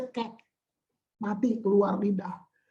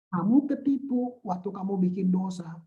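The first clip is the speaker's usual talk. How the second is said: ordinary talk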